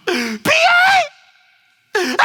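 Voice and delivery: high-pitched hammed-up wail; exaggerated blubbering cry; mock crying. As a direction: imitate a girl bawling down the phone. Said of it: "and she's crying".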